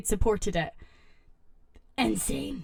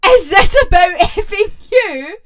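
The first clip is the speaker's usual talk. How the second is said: higher, louder read-out yell; retold yell; 7 semitones higher, 11 dB louder than usual. Reading the Reddit mum yelling at her son, loud. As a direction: give the mum's outburst full volume. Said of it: "and yelled".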